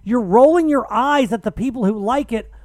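arguing back at a Twitter troll, loud and exasperated; exasperated rant. Retold or imitated. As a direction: let it rip in disbelief. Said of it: "And I'm like".

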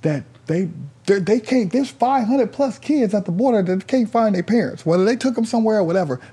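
high, loud, urgent insistence; heated argument. Retold or imitated. Storyteller pressing the man about kids at the border, heated. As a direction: argue it high and pressing, stumbling into it.

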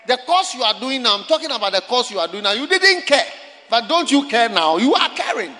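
full-force preacher's shout, pitched high; loud, high, insistent challenge; real confrontational shout. Real, not acted. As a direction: hammer it at the crowd, very loud.

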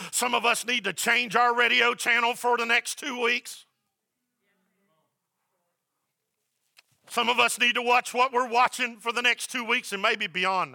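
high, loud sermon delivery. Acted. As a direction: keep the pulpit energy up.

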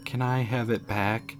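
put-on hushed ghost child asking; little ghost girl. A narrator's reading. Ghost girl asks again.

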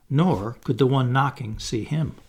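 plain reading voice

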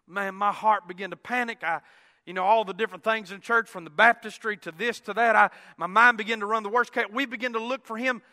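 normal talking voice

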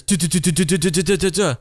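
quick run of high "duh, duh" taps for lights dropping off clips; imitated falling lights. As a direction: rattle off the sound of clips popping one by one. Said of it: "and I hear this".

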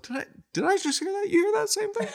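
can't believe what he just heard; disbelief. Retold or imitated. Inner voice, disbelief at confession.

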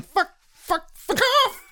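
high squeaky fuck fuck fuck off; little high-pitched cursing; squeaky mock swearing. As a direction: squeak it out like a tiny angry creature. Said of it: "we need them all going".

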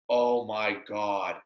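slow, low, soft realization; quiet dismay. Retold or imitated. He finds hickeys on his neck, quiet dismay. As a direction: say it low and slowly, as it sinks in.

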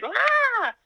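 high, loud frustrated yelp; annoyed shriek. Retold or imitated. Wife shrieking in annoyance at her husband's suggestion. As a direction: let out an irritated shriek at him.

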